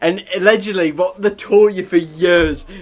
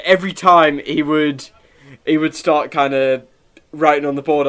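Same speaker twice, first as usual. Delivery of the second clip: usual speaking voice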